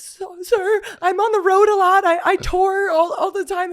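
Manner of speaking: high, polite mock excuse; high sweet plea. Friend pleads with cop, high sweet voice. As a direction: sweet-talk the officer. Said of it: "she was like".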